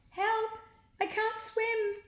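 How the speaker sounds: very high panicked cry for help. A narrator's reading. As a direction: shriek for help, drowning. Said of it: "the headmaster cried".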